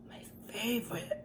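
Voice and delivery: breathy whispered aside. Real, not acted. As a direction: whisper it to yourself. Savouring food, whispered aside.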